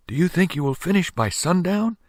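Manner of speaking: put-on high, curious child voice; a small boy asking eagerly; little boy. Little boy asks Mike.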